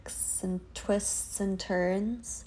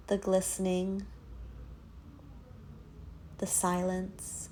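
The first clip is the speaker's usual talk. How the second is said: guided meditation. Acted. Yoga teacher leading listeners through a dark tunnel visualization, guided meditation. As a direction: guide them gently inward, leaving space after each phrase.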